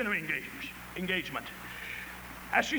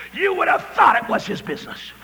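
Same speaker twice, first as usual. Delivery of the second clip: emphatic punchline; 3 semitones lower, 6 dB louder than usual. Retold or imitated. Wife's punchline, loud.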